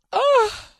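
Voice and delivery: high squeaky yelp for the mirror; comic high-pitched "oh"; mock falling yelp. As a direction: voice the mirror as it drops off.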